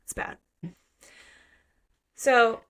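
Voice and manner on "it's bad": whispered, dismayed under the breath; hushed dread. Retold or imitated. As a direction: breathe it out in horror. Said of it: "and you're like".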